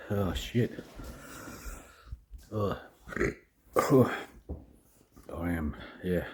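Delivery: gasping through a burning tongue; strained, tongue on fire from chili; mouth burning from spicy food. Real, not acted. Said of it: "Tongue is burning like nothing".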